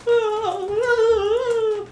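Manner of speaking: high, thin acted screaming; held-back scream. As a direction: scream without full volume, baby sleeping nearby. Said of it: "one of them just keeps screaming".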